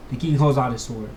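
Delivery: usual speaking voice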